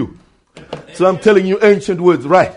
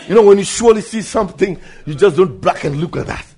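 ordinary talk

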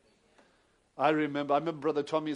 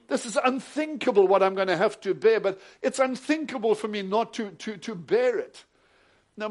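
rising, louder anguished inner plea; Jesus in anguish; 5 semitones higher, 8 dB louder than usual. Acted. Jesus crying out to his Father before the cross, anguished. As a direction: pour out the dilemma to the Father.

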